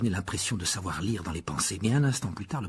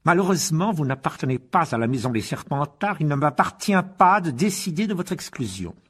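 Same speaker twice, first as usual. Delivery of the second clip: Rogue menaçant; 5 semitones higher, 3 dB louder than usual. A narrator's reading. Professeur menace d'exclusion.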